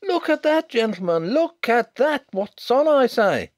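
blustering bookmaker. Acted. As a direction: demand your safe like an outraged bookmaker.

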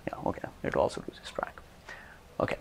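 half-whispered aside to himself. Real, not acted. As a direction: mutter it under your breath. Aside to himself watching clip.

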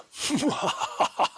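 high unvoiced cackling evil laugh. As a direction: cackle like a gleeful villain. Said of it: "That's my evil laugh there".

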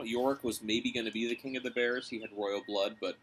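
plain reading voice

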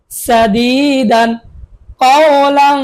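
long drawn-out syllables; slow recital. Shown as a demonstration. Teacher slowly sounds out সাদিদান for pupils. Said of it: "আমরা পড়বো".

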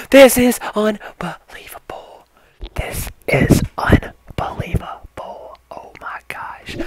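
slow, drawn-out breathy whisper; awestruck whisper. Acted. Vlogger overwhelmed by the new controller, awestruck whisper. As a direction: whisper it in stunned disbelief. Mock awe after the hype reveal.

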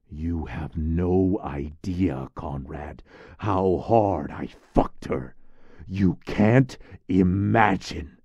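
drawn-out, loud and heavy, each word leaned on; gloating over a shocking secret, slowly; slow intense confession. Acted. Man on the projector gloats to Conrad, slow and loud.

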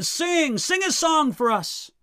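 high-pitched jeer, no real volume; mock drunken yell. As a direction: imitate partiers calling out a demand. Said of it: "yelling at them".

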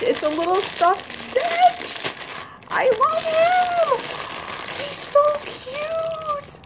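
very high, slow squeal, near a shout; adoring squeal. Real, not acted. Hugging the plush from her friend, squealing adoringly. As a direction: coo and squeal over something adorable.

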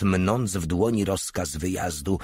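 usual speaking voice

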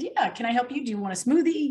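high, chirpy service voice; helpful counter worker. Retold or imitated. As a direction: offer help brightly to a customer. Retold counter worker greeting a stranger.

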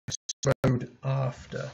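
"strode after" drawn out slowly with both syllables stressed; slow spondee stress. Shown as a demonstration. Teacher sounding out the spondee for students, slow even stress. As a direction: give "strode" and "af" equal weight, slowly. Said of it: "we have these stresses".

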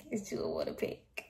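breathy whispered aside. Real, not acted. Vlogger whispering advice to viewers. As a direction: tell them under your breath. Aside after laughing at herself.

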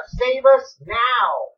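slow, stretched shout of save us now; a crowd begging out loud, slowly; pleading shout. Acted. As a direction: drag each word out in a crying plea.

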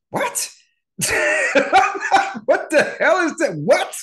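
high, loud laughing what-the-hell; incredulous laughing outburst; laughing disbelief. Retold or imitated. Reacting to mom's request.

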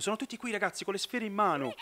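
normal talking voice

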